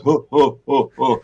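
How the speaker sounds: lightly voiced ho-ho chuckle of a big man; a hint of a jolly Santa laugh; Santa Claus laugh. The dad laughing at his son, a mild Santa chuckle. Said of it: "it sounds like Santa Claus".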